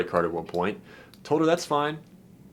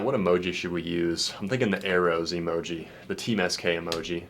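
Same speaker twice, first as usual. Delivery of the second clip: ordinary talk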